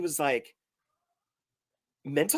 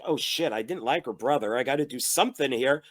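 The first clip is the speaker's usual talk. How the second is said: low-pitched mock inner monologue; imitated sly aside to himself; scheming inner thought; 7 semitones lower than usual. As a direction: drop low, as if plotting privately in a mocked character's head. Imagined boyfriend's private scheming thought.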